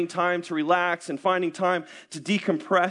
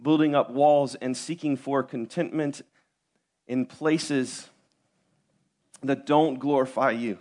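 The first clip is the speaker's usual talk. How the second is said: prayer; 6 semitones lower than usual. Acted. Pastor's opening prayer.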